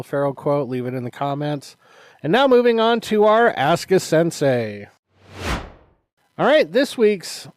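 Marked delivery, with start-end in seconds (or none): 2.2-7.5 loud segment intro; excited host. Real, not acted. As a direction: announce the next segment with energy. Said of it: "segment announcement".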